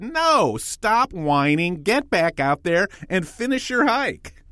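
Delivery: high-pitched, stern mock scolding; unsympathetic wife. Wife refusing to let her husband quit his hike, mock stern. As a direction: retell a tough-love order, pitched well up. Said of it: "with all the sympathy in the world, she said".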